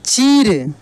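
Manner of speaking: चीर with the ī stretched; drawn-out long ī. Shown as a demonstration. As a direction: blend चीर, holding the ī long. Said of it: "खींच कर किया जाता है". (चीर की ई खींच कर)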